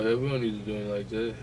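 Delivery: ordinary talk, nothing marked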